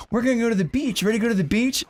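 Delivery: family voice. Retold or imitated. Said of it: "my family was like".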